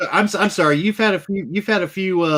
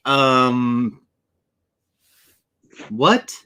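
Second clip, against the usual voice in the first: long low "um", then a rising "what"; stunned hesitation, lightly acted; mildly taken aback; 4 semitones lower than usual. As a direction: act baffled, with a long pause before the question. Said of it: "I'd be a little taken aback by that".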